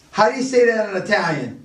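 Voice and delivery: usual speaking voice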